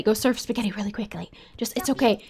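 soft unvoiced whisper, quick; urgent aside whisper. Real, not acted. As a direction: hurry it out under your breath. Urging sim in an aside.

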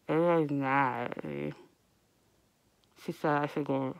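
ordinary talk, nothing marked

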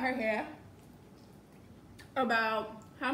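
chewing while talking; finishing a bite; mouth full. Real, not acted. Seen 0: Bites broccoli at -2; hand over chewing mouth until 0.9.

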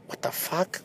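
breathy whispered disbelief; hushed stunned curse; shocked whisper. Retold or imitated. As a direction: whisper it in shock, barely voiced. Retold shocked thought, whispered.

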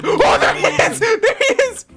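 real high-pitched excitement; excited shout. Real, not acted. Player spotting Dad in the game, excited shout. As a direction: cry out high, laughing.

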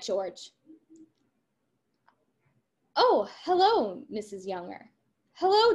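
lively read-aloud of the script's dialogue, voices lightly acted; reading a play aloud. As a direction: read the scene to the class, giving the lines some life.

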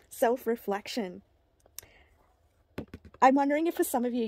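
normal talking voice

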